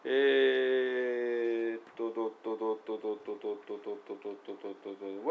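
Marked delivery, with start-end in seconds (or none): 2.1-5.3 fast repeated dot dot beats; rhythm beat. Shown as a demonstration. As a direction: tap out the rhythm with your voice. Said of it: "ダーダーダーというようなイントネーション".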